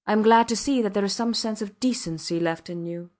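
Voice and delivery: ordinary delivery, nothing marked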